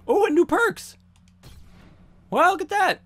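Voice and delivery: very high, loud pleasure; delighted squeal. Real, not acted. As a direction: gush over the reward. Delighted by new perks.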